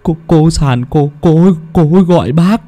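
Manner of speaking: high, halting terrified stammer; stammering in fear. Acted. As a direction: stutter each word, scared stiff. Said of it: "mà lắp bắp". (lắp bắp sợ hãi)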